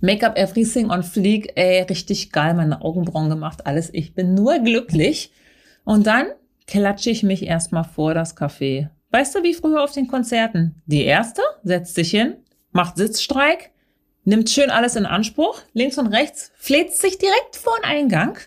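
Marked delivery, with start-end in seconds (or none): none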